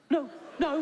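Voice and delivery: very high panicky no; flustered denial. Retold or imitated. Accused comic fending off a woman, high panicky. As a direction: squeak out a frantic no.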